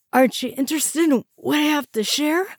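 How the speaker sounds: high, loud, frightened plea; panicked plea. A narrator's reading. Strapped prisoner begging officers, frightened and high. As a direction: plead in panic from the gurney.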